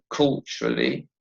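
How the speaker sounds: "culturally" in three syllables, dropped low; "culturally" measured out, low and falling. Shown as a demonstration. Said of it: "has three syllables".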